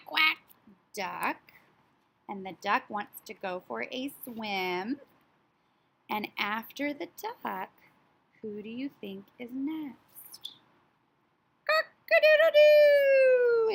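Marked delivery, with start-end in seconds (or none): 0.1-0.4 higher, louder quack; duck quack. Acted. Teacher quacking as the duck for children, loud. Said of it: "is the quack". 11.7-13.7 very high, loud, slow crowing; rooster crow. Acted. Teacher crowing as the rooster for her class, very high and loud. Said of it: "It's the rooster".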